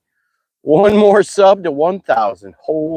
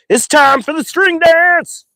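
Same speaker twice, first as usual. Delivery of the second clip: sing-song announcement; 3 dB louder than usual. Real, not acted. Announcing celebratory string dance.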